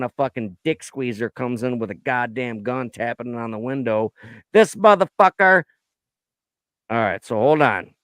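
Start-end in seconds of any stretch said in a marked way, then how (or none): 4.5-5.6 angry outburst. Real, not acted. Angry outburst about shooter.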